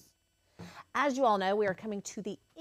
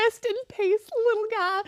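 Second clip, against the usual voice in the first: high, louder mock mourning voice; mock tearful farewell; 11 semitones higher, 5 dB louder than usual. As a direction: bid it farewell, hamming up the grief. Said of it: "she's like".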